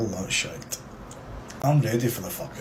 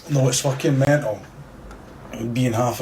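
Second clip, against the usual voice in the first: angry; 3 semitones higher, 5 dB louder than usual. Real, not acted. Angry rant.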